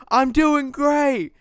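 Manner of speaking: high, loud, stretched-out yell; blacksmith's helper shouting. Acted. Game master voicing Steven at work, high drawn-out shout. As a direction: holler it over the forge noise. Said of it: "and then you just hear".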